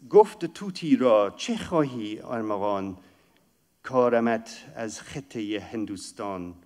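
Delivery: quick, lilting recital of Persian poetry; Persian verse recital. Acted. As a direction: chant the couplet like a reciter.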